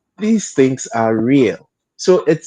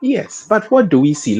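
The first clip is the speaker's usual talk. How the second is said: usual speaking voice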